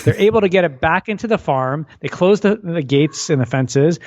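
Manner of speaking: ordinary delivery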